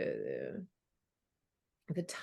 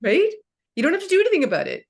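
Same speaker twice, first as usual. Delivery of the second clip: higher, louder, rushing fast; fast excited burst of enthusiasm; 3 semitones higher, 7 dB louder, much faster than usual. Real, not acted. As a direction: tumble the words out eagerly. Excited agreement about journal idea.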